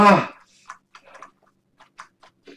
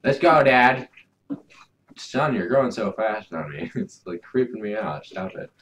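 usual speaking voice

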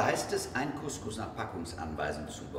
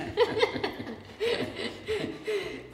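laughing; 10 semitones higher, 5 dB louder than usual. Real, not acted. Laughs at spinach mix-up.

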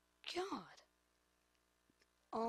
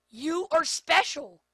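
mocking insult; 4 semitones higher, 12 dB louder than usual. Real, not acted. Mocking his goalkeeper.

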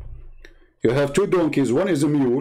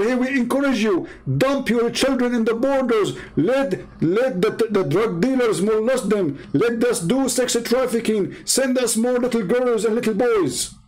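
higher-pitched, mocking version of politicians urging people on; sarcastic mock announcement; 7 semitones higher than usual. Mocking politicians' message to people about the border, sarcastic mock appeal. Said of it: "Yet we say to people".

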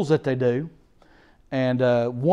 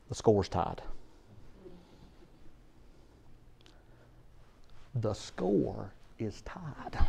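much softer, slow and low; dropped to a near hush; hushed realization; 6 semitones lower, 17 dB quieter, much slower than usual. Acted. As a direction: let the fact sink in quietly. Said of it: "One problem".

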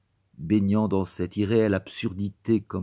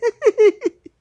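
very high shrieking burst of laughter; servant's laugh; 7 dB louder than usual. Acted. Pinched servant girl bursting out laughing, high shriek. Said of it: "en éclatant du rire d'une servante".

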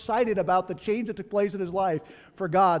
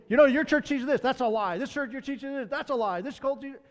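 ripping on them; 7 semitones higher than usual. Retold or imitated. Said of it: "rip on them".

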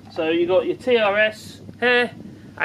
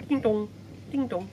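soft, high ding-dong chime; imitated bell; 4 semitones higher, 7 dB quieter than usual. Vlogger imitating the notification bell, soft chime. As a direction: sing it lightly like a doorbell. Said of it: "Little bell thing".

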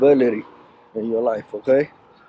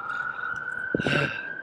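tired sigh; 3 semitones higher, 9 dB quieter than usual. Real, not acted. Tired sigh mid workout vlog.

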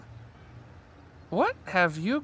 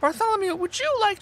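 exasperated; 13 semitones higher than usual. Acted. Exasperated greeting to friend.

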